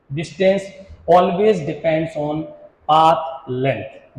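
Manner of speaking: very slow, word by word, pause before the last word; slow dictation. Shown as a demonstration. Teacher dictating the definition to students, slow and spaced. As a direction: spell it out for notes, slowly.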